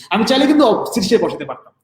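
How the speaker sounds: usual speaking voice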